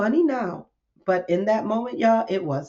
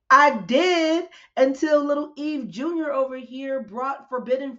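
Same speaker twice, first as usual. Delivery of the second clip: emphatic protest; 8 semitones higher than usual. Retold or imitated. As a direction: throw it back, insisting. Answering God emphatically.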